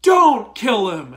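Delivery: loud, drawn-out pleading no; hammed-up cry of don't kill him; loud, slowed imitation of Layla; mock Layla plea. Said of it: "And then Layla goes".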